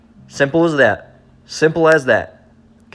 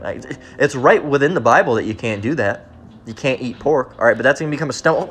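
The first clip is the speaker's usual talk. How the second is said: normal talking voice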